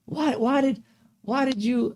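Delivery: high-pitched mock complaint; imitated complaining ex. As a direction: pitch it up like a nagging girlfriend. Imitates ex starting an argument.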